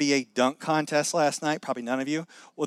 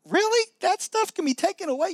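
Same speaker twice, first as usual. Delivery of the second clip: high, incredulous question; surprised disbelief. Pretend. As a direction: sound amazed it could be erased. Imagined listener doubts forgiveness.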